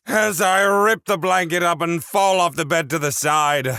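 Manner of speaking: high-pitched telling, easing down toward the end; frantic story narration. A narrator's reading. Narrator telling of falling off the bed, frantic. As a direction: narrate the tumble, still worked up.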